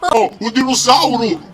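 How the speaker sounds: high, loud alarmed cry; alarmed shout. Pretend.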